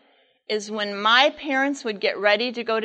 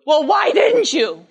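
exasperated shout; 5 semitones higher, 7 dB louder than usual. Retold or imitated. Mother scolds father.

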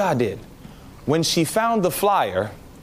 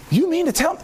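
incredulous; 12 semitones higher than usual. Retold or imitated. Disbelief at the sermon.

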